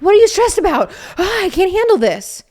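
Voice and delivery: imitating her husband catching her stress, hammed-up panic; mock frantic husband. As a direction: overreact like a stressed-out partner. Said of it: "Then he'll be like".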